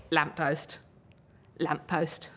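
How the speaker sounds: low, hushed poem ending; low and soft, like a goodnight. Acted. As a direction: let the verse sink down softly. Poem's tender goodnight to a lamppost.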